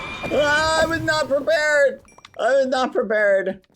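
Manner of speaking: high frantic shriek; panicked yell. Real, not acted. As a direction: shout it in panic. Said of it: "That scared me".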